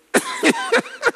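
high-pitched giggle; helpless, real high giggling. Real, not acted. Preacher amused by people's phones, giggling.

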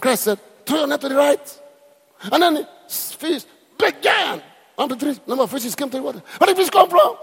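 high and loud, building; imitating a preacher at full cry; preacher's shout. Preacher voiced, climaxing.

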